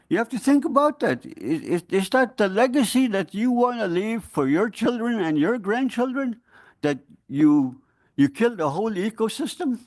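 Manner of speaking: confronting officials over the vanished salmon, pitch rising in anger; heated plea. Real, not acted. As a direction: voice climbing high, urgent and accusing. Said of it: "I'm very sorry that I have to speak like that".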